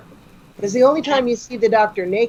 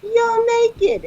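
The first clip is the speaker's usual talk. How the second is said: high-pitched mock shriek; imitated scandalized outburst; shocked exclamation; 14 semitones higher than usual. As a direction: cry it out high and stretched, comically appalled. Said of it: "And she goes".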